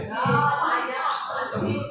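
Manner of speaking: stretched slow, mock-shocked astagfirullah; hammed-up dismay at forgetting the greeting; drawn-out astagfirullah. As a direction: drag it out like a comic gasp of dismay.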